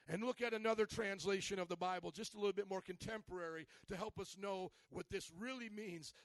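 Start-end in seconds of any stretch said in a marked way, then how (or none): none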